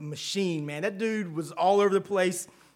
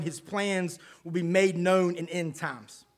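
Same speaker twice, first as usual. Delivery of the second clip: ordinary talk, nothing marked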